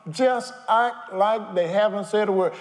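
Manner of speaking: normal talking voice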